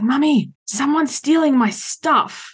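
put-on high, drawn-out child's complaint; seven-year-old son. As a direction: imitate a little boy calling to mum, slowly. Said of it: "My seven-year-old has just started playing".

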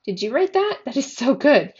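high, loud amazed gush; shocked and thrilled. A narrator's reading. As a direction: burst out, thrilled by what you just heard. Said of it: "shocked and kind of thrilled".

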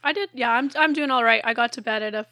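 ordinary delivery

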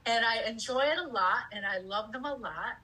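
usual speaking voice

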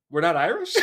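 loud, high startled question; shocked disbelief. Retold or imitated. Son hearing dad deny Irish roots, shocked. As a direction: blurt it out in disbelief. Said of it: "And I was just like".

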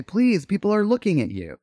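a light touch of the sweet Rose Nyland; sweet Rose line. Retold or imitated. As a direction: scold her kindly as the sweetest woman alive. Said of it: "I am playing America's sweetheart".

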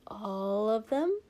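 slow question with rising lilt; too innocent. A narrator's reading. Servant teasing his prince, too innocently. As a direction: draw it out, feigning innocence. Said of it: "asked a little too innocently".

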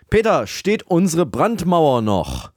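ordinary talk, nothing marked

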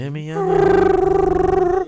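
squeaky mock voice. Teasing call invitation.

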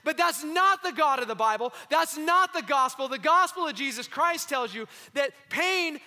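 loud, impassioned sermon delivery; full-force preaching. Acted. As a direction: preach it hard to the congregation.

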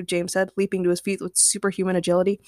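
ordinary delivery, nothing marked

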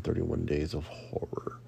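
spooky whisper. Acted. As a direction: hush it like a secret. Spooky whispered show title.

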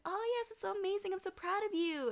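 high, quick, gushing praise; proud mother. Retold or imitated. Mother praising her daughter's learning, gushing. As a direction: coo proudly in a high mommy voice.

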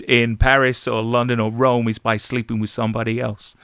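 stage American accent from a musical. Shown as a demonstration. Actor recalling his Cabaret line, in an American accent. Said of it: "I had to do an American accent".

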